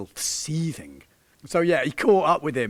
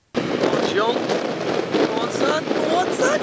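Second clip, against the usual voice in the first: cheering on; 12 semitones higher, 4 dB louder, slower than usual. Real, not acted. Cheering the rider on.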